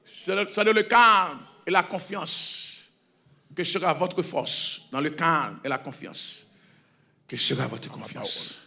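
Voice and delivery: hushed closing reading; soft scripture reading. Acted. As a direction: let the verse sink in gently. Reads verse softly to close.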